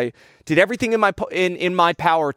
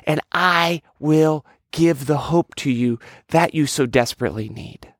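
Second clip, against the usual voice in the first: voice of God; slower than usual. Acted. God calling people to put faith in him, slow and stately. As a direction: speak as God, drawing out each phrase. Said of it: "this is God, I am sitting there going".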